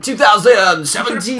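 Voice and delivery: loud, high announcer call; announcer shout. Acted. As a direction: belt out the year like a host. Hammed-up outro year, shouted.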